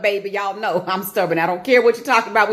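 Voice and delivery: ordinary talk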